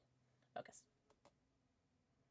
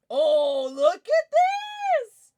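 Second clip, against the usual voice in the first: high, loud excited shout; 11 semitones higher, 14 dB louder, much slower than usual. Real, not acted. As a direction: call it out thrilled and loud. Spotting something exciting on stream.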